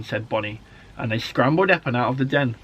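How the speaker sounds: ordinary delivery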